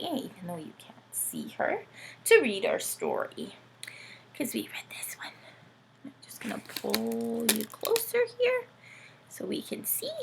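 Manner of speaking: soft whispered aside. Real, not acted. Arranging the puppet on her lap, whispering. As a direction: murmur it while settling in.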